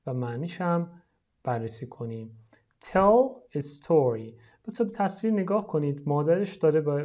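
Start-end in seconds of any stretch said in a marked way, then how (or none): 2.8-4.3 slow model phrase. Shown as a demonstration. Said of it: "لطفاً تکرار کنید".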